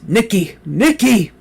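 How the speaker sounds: high, loud cry rising on the second call; screaming for help. A narrator's reading. Dragged from bed, calling his companion, loud panicked cry. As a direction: yell his name in panic. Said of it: "as loud as I could".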